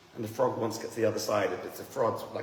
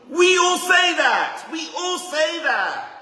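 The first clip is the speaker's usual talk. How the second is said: high, loud, slow proclamation; mock shout; 11 dB louder, much slower than usual. Preacher mocking the excuse we all give, high and loud. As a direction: drag it out like a chant. Mocks everyone using the excuse.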